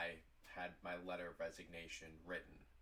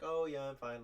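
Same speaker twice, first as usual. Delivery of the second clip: higher, louder, breezy cover-up; playing it off; 7 semitones higher, 8 dB louder than usual. Employee playing it off to her boss, breezy reassurance. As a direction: brush it off too brightly. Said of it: "I'm gonna play it off".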